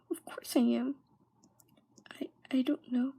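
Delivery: whispered, broken self-doubt; despairing whisper. Retold or imitated. Overwhelmed girl answers her own fears, in a defeated whisper. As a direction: let it fall apart into a hopeless whisper.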